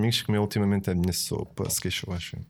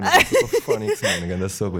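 boastful exclamation; 3 semitones lower, 4 dB louder than usual. Real, not acted. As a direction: show off, pitching way up. Bragging about his soup.

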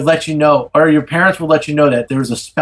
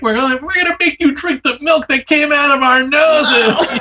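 character quote; 10 semitones higher than usual. Retold or imitated. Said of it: "she's like".

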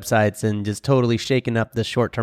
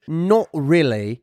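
slow English accent, pitched up; attempted English accent; 4 semitones higher, much slower than usual. Shown as a demonstration. Co-host repeating his demo for guest, attempted English accent. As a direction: stretch it out in a put-on English accent. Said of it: "Rob, speak in your English accent".

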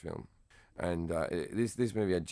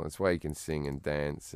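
ordinary talk, nothing marked

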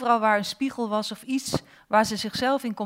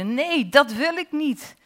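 loud, higher-pitched protest; angry shouting; 6 semitones higher, 5 dB louder than usual. A narrator's reading. Girl to the face in the water, shouting angrily. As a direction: shout out a furious refusal. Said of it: "Ze schreeuwde".

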